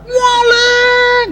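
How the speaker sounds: very high, shrieked alarm; piercing yell. Retold or imitated. Preacher imitating someone who catches the thief, piercing yell. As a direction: cry out piercingly like a woman singer. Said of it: "teriakan kan melengking tuh kayak bidu wanita".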